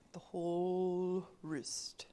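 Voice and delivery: slow whisper. Real, not acted. Coach stretching the words.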